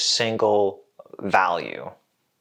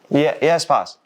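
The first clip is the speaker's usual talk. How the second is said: higher, keen yes-man voice; eager young worker; 5 semitones higher, 4 dB louder than usual. Acted. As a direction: jump to attention for the boss. Eager worker answers his boss.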